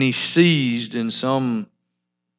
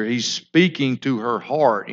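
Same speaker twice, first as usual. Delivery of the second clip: ordinary talk, nothing marked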